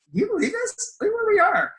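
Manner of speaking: very high, squeaky retold amazement; imitating two friends in giddy disbelief; awestruck excitement. Retold awe at the convention.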